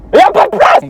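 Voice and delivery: very high, loud protest; exasperated yell. Real, not acted. Driver stuck in traffic yelling in exasperation. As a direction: cry out in disbelief.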